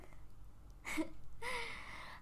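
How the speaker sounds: quiet laugh, rising in pitch; soft disbelieving laugh. Acted. Vampire falling for a human, disbelieving soft laugh.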